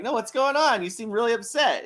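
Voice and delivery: high, coaxing worried voice; gently pry, sounding worried; a hint of concerned friend; lightly acted concern. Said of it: "I was like".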